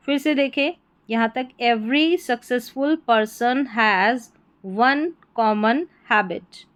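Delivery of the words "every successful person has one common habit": very slow model reading; unhurried read-out with clear gaps; word by word. Shown as a demonstration. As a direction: separate each word for learners. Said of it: "ऐसे आपको repeat करके".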